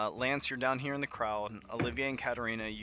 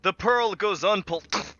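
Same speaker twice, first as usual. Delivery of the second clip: loud, slow declaration choked off mid-word; cry cut by choking; 8 semitones higher, 11 dB louder than usual. Acted. Condemned man as the barrel goes, loud cry choked off. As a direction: call out until the noose cuts it off.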